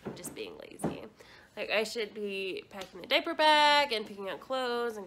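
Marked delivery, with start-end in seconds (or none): 0.0-1.0 whispered confession. Real, not acted. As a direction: whisper it to the camera. Aside to camera.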